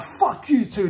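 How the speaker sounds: high-pitched retold angry outburst; high, indignant retold retort; full anger mode. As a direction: act out snapping in anger, pitch jumping up. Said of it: "I'm in full anger mode".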